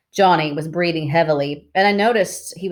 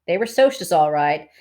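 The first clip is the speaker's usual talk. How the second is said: ordinary delivery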